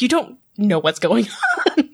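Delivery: words breaking into a laugh; sheepish, giggly admission; nervous laughter. Real, not acted. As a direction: laugh through the end of the sentence. Laughing about own nerves.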